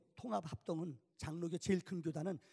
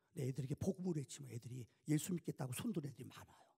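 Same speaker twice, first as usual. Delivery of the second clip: ordinary talk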